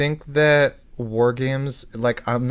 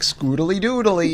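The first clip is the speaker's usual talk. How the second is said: Ned Flanders voice; 6 semitones higher than usual. Retold or imitated. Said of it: "It's like a Ned Flanders, but ... Wisconsin area".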